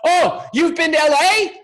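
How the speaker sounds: high, gushing show-off voice; mocking boastful guy. Mimicking the speaker who bragged about LA, gushing. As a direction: lay on the smug travel bragging. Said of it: "and he goes".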